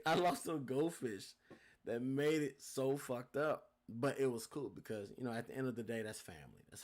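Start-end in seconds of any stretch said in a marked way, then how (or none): 0.1-3.6 laughing through the words, louder. Real, not acted. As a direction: laugh as you admit the loss. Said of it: "laughing while saying it".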